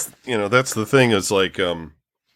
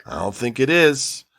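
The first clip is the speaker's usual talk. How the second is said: skeptical; much slower than usual. Retold or imitated. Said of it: "looking at it skeptically".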